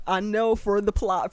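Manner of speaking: ordinary delivery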